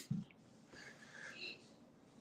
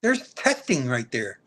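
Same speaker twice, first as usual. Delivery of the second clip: ordinary delivery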